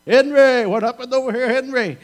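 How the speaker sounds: loud, high alarmed call. Retold or imitated. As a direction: yell across the stage for your brother. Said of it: "he turns around and says".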